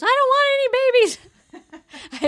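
put-on high, loud childish outburst; mock wailing protest. Daughter rejecting mom's talk of babies, hammed-up yell. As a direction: imitate a kid yelling her refusal.

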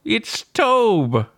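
high olde English proclamation; town crier. Pretend. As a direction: proclaim it like a herald. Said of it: "I'm kidding, of course".

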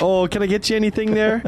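high polite Minnesota host; Midwest accent. Retold or imitated. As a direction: offer it Midwest nice. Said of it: "Midwest as fuck".

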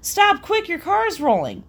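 high-pitched mock yell; retold scream. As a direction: imitate an urgent warning shout. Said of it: "I screamed out to him".

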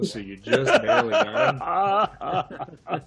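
high, loud laughter through the words; real laughing. Real, not acted. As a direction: break up laughing while talking. GM laughs while telling result.

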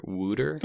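"wooter" said as WOO-der for water; Philly-slang "wooter"; Philadelphia slang pronunciation. Shown as a demonstration. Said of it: "Philadelphia slang is calling water wooter".

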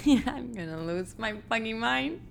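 amused exasperation, words squeezed through a laugh. Real, not acted. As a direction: laugh helplessly at your own stumbling. Laughing at own repeated stumbles.

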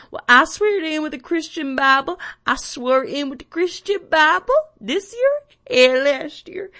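imitating a flustered Southern accent, high and loud; Roy Moore. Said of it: "And he was like".

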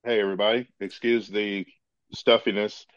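ordinary delivery

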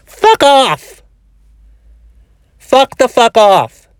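fed-up shouting; angry outburst. Real, not acted. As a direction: snap and yell it at the fearmongers. Ranting at fearmongering news.

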